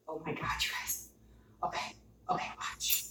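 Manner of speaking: breathless whisper to the viewers; excited whisper. Real, not acted. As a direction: whisper it like a thrilling secret. Excited over food, to viewers.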